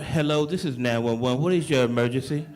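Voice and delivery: a deep, businesslike dispatcher answering the line; 911 operator. Acted.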